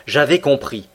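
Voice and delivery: ordinary delivery, nothing marked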